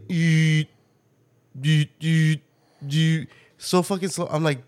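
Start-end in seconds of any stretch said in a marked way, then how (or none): 0.1-3.3 mimicked eet noise. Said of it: "Now he's like".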